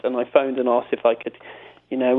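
normal talking voice

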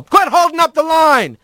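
high-pitched scolding holler; straw boss yell. A narrator's reading. Straw boss to the rat stalling the line, yelling. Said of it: "he heard the straw boss yell".

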